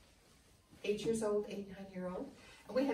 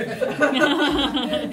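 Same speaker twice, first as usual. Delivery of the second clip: big real laugh, higher and louder; loud burst of laughter; 9 semitones higher, 19 dB louder than usual. Real, not acted. Laughing at her own mix-up in front of visitors.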